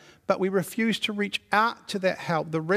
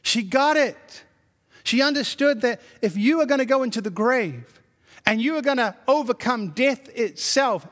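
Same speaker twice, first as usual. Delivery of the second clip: ordinary talk, nothing marked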